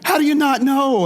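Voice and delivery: high, slow, incredulous Jim voice; Jim from Taxi. Retold or imitated. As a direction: play the spacey Taxi character baffled. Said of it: "talking like Jim from Taxi".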